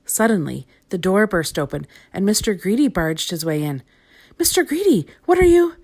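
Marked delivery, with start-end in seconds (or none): 4.4-5.7 high, rising surprise at the intruder; a shocked little girl cut off mid-question; startled protest. A narrator's reading. Little Miss Sunshine startled by Mister Greedy barging in. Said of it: "began Little Miss Sunshine".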